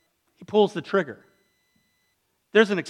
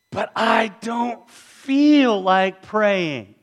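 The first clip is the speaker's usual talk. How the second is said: slow, higher, dragging complaint; reluctant whine; 5 semitones higher, much slower than usual. Acted. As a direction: drag it out like a sulky excuse. Voicing reluctant listener.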